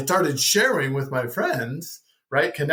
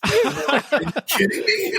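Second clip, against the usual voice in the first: incredulous laughing quote; 13 semitones higher, slower than usual. Retold or imitated. Friends react to him quitting.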